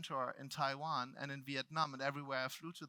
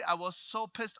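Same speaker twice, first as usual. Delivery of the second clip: usual speaking voice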